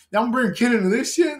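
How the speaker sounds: high, loud disbelief; incredulous quote. Retold or imitated. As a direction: scoff at having a kid now. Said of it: "It's like".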